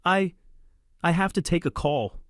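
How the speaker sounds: high, tearful voice on the verge of breaking; voice cracking. A narrator's reading. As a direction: voice cracking as she tries to whisper. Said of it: "her voice cracking".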